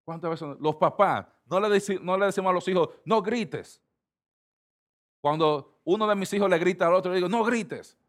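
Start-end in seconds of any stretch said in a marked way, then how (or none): 3.1-3.8 higher-pitched imitation of a parent's scolding; mock parental yell. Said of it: "yo grito también para que ellos dejen". 7.3-8.0 high-pitched scolding, only half shouted; mock parental yell. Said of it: "yo grito también para que ellos dejen".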